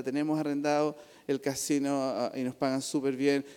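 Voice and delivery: normal talking voice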